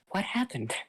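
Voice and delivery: high, baffled question; stunned. Acted. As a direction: ask it in total disbelief. All Might stunned, asking again.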